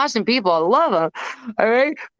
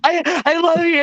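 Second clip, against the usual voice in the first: high, excited gushing; high, slow fanboy squeal; 6 semitones higher, slower than usual. Retold or imitated. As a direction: gush like a starstruck fan. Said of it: "he goes".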